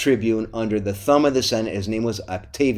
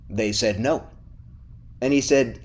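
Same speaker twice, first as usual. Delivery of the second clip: normal talking voice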